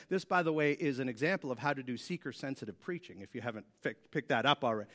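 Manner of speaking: low, soft, deadpan aside; a little sarcastic. Real, not acted. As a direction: drop the voice for a dry aside. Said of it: "I'm being a little sarcastic there".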